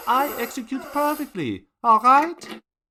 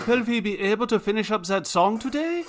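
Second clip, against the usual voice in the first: ordinary delivery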